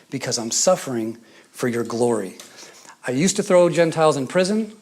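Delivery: ordinary talk, nothing marked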